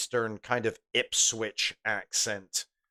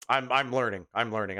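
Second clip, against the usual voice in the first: usual speaking voice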